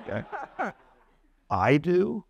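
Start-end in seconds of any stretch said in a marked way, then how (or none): 1.5-2.2 "I do?" with I pitched high and questioning; stress on I. Shown as a demonstration. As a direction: hit the I in "I do?", doubtful. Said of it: "four different stress patterns".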